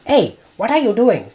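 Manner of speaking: imitating a strict aunt catching you wasting food; scolding aunt. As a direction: bark it like the watchful aunt. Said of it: "I could just hear her going".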